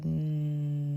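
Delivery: soft, sustained level hum; long hum. Shown as a demonstration. Showing listeners the exhale and hum again, long hum. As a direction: let the breath out in a hum. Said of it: "exhale and hum again".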